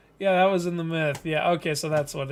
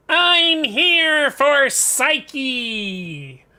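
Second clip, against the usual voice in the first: very slow, stretched-out mock hero line, slightly higher; Eros voice; 7 semitones higher, 4 dB louder, much slower than usual. Eros storming in for Psyche, slow mock-menacing Eros voice. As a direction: drag it out like a would-be menacing god, not really intimidating. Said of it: "in my Eros voice".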